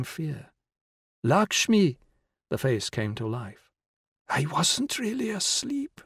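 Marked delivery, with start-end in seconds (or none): 1.2-2.0 urgent call. A narrator's reading. Calling to rouse dying woman. 4.3-6.0 high, slow frail whisper; old woman whispering. A narrator's reading. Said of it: "the old woman whispered".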